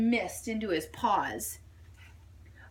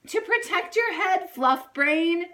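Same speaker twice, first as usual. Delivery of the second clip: high, louder sassy retort; know-it-all; 8 semitones higher, 4 dB louder than usual. A narrator's reading. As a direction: tease him as a know-it-all. Doll scolds bunny.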